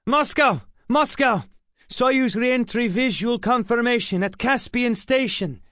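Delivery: bursting with pride on the radio; proud excitement. A narrator's reading. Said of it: "spoke with pride and excitement".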